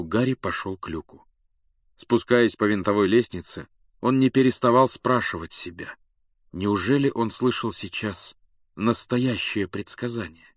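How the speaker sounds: ordinary delivery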